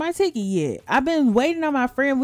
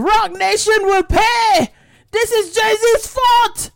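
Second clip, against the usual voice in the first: high, loud drawn-out mock outrage; imitated yelling; 10 semitones higher, 6 dB louder, slower than usual. Host imitating angry daddy outside courtroom, yelling. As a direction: yell it slowly like an irate protester.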